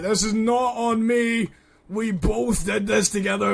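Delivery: loud, high retold protest; indignant protest. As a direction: push it out high and insistent.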